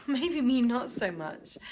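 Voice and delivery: ordinary talk, nothing marked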